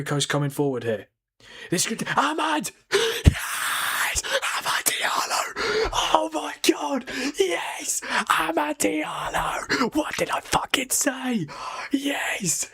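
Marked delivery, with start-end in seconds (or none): 1.7-12.7 high, strained whisper-scream of joy, held back so as not to wake the house; hushed goal scream. Real, not acted. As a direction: celebrate a late winner at night, screaming in a whisper. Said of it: "I can't be too loud 'cause I'm gonna wake everyone up".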